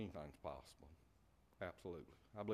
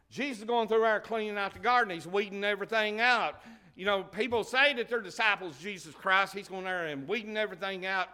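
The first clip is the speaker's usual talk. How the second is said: loud, high fervent preaching; 8 semitones higher, 9 dB louder than usual. Acted. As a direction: preach it out to the church with fire. Preaching at full force.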